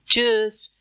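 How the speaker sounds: चीयर्स said as tsch-üss with rounded ü; German Tschüss. Shown as a demonstration. Teacher introducing चीयर्स pronunciation. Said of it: "इस greeting की pronunciation है चीयर्स".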